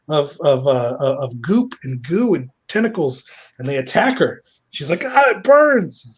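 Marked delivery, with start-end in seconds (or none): 5.0-6.1 high, loud, slow wail; mock pained cry. Susie attacked by the monstrosity, mock pained. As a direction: cry out in hammed-up agony. Attacked character cries in pain.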